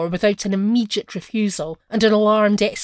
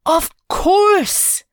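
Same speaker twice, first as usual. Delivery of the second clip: much higher, louder, joyful cry; 7 semitones higher, 5 dB louder than usual. A narrator's reading. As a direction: burst out with delight. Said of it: "uttered a cry of joy".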